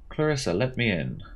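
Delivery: ordinary talk, nothing marked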